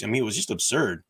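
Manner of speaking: ordinary talk, nothing marked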